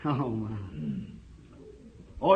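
usual speaking voice

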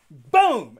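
sudden shouted boom; loud boom sound effect. Retold or imitated. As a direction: shout the impact like a crash. Imitating the bandsaw bounce.

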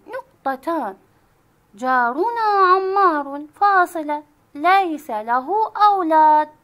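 loud, deliberate read-aloud naming each punctuation mark; loud classroom dictation voice. Shown as a demonstration. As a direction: read slowly for the class to repeat. Teacher reads passage with punctuation aloud.

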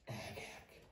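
breathy whispered dismay; whispered sigh. Acted. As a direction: whisper it to yourself in despair. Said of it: "sóhajtotta doktor Reaper".

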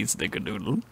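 ordinary talk

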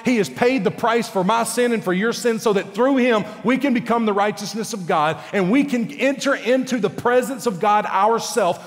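ordinary talk

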